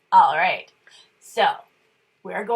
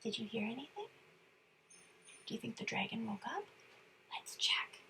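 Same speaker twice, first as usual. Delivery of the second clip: much softer, whispered questions; hushed; 18 dB quieter, much faster than usual. Real, not acted. Asking the kids quietly, hushed. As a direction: hush so the dragon sleeps. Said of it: "checking on sleeping dragon".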